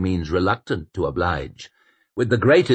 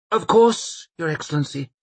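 grovelling mayor; 9 semitones higher than usual. A narrator's reading. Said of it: "murmured the mayor".

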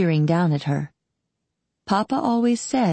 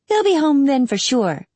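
higher-pitched, lightly voiced eager girl; eager assurance; 8 semitones higher, 4 dB louder than usual. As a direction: chime in keenly as the kid sister. Eager girl answers at once.

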